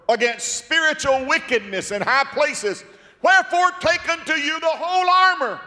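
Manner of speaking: high, loud rising cry; full-force sermon cry; preaching climax. Real, not acted. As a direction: build to the peak.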